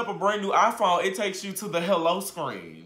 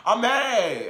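ordinary delivery

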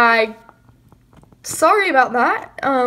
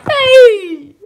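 loud, high offended yell; 7 semitones higher, 6 dB louder than usual. Acted. Insulted over the unibrow, indignant yell. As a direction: yell it in protest. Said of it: "What is all this yelling?".

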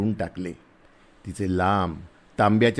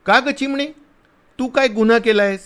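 much higher, louder playful voice; teasing soldier; 13 semitones higher, 4 dB louder than usual. A narrator's reading. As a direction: tease her like a little sparrow. Soldier teasingly asks the girl.

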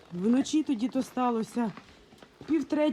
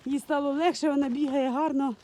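ordinary talk

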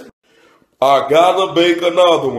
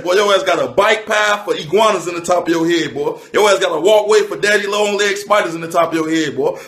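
ordinary talk

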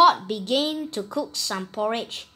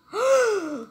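dramatic gasp; 10 semitones higher, 3 dB louder than usual. Acted. Narrator reacts to having no food.

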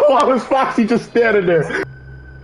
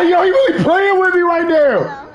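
scared horror game streamer; 3 semitones higher, much slower than usual. Real, not acted. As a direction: freak out at the jumpscare. Panicking at animatronic attack.

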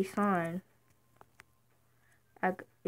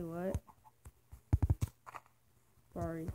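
usual speaking voice

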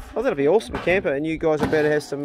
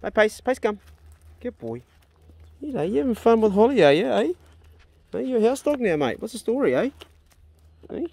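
dog talk; 8 semitones higher, 3 dB louder than usual. Real, not acted. Talking to his old dog.

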